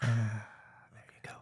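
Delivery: slow, breathy ASMR whisper. Pretend. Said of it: "A S M R".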